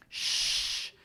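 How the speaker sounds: SH held long as one continuous hiss; sustained sh sound. Shown as a demonstration. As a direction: draw out "sh" as continuous friction. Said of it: "I can keep that sound going".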